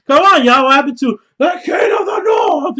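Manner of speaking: loud, high-pitched mock protest; mock outrage. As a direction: cry out in playful disbelief, voice jumping up. Mock outcry at fickle crowd.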